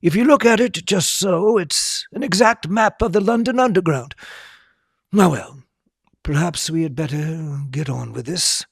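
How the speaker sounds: lightly voiced genial old headmaster; Dumbledore voice. A narrator's reading. Dumbledore voicing, reading aloud.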